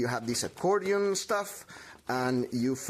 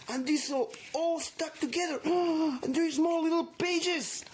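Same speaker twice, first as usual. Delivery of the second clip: much higher, gasping wonder, in a voice unlike her own; amazed excitement; 13 semitones higher than usual. Acted. Crafter gasps over her finished notebook, much higher amazed wonder. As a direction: marvel at the notebook like a thrilled kid.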